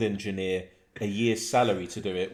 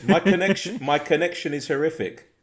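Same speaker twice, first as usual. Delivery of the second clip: mock whiny complaint; 8 semitones higher, 8 dB louder than usual. Voicing James in chat.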